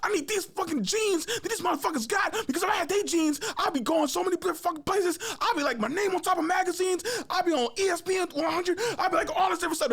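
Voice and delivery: very high-pitched, ranting protest; re-enacted cussing storm. Player refusing water, cursing up a storm at coach. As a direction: mimic an unstoppable angry tirade. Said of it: "I'm just cussing up a storm".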